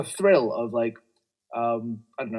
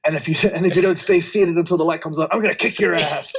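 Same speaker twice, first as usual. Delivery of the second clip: pilot announcement turned wrestling promo; louder, higher trash-talk on the tannoy; 5 semitones higher, 4 dB louder than usual. Pretend. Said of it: "start doing a wrestling promo".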